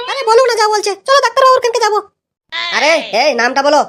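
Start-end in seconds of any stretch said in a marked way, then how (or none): 0.1-2.0 high, pleading put-on woman's voice; a flustered wife pulling her husband back; wife's voice. Wife calming her angry husband at the clinic, high pleading woman's voice.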